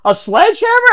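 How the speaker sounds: high, loud mock astonishment; retold squeal of disbelief; incredulous. Prankster answers head of security, mock-incredulous.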